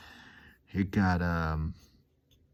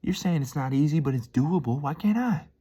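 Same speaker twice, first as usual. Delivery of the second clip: rising self-pep talk; 7 semitones higher than usual. Retold or imitated. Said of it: "You're like".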